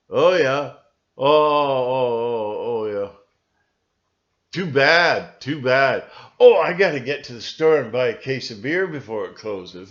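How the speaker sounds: loud, uncaring brush-off; dismissive. Retold or imitated. Hybrid brushing off your troubles, loud dismissive tone. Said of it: "They just look at you like".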